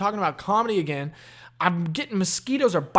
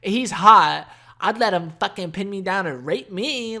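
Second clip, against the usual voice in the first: hammed-up higher girlish gushing; mock girl voice; 4 semitones higher, 7 dB louder than usual. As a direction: gush like a swooning fangirl.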